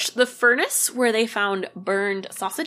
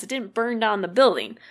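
usual speaking voice

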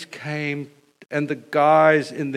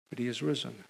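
softer, lower: but he is risen; quiet refrain; 4 semitones lower, 12 dB quieter, faster than usual. Acted. Preacher answering each suffering of Christ, soft and low. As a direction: let the answer fall soft and sure.

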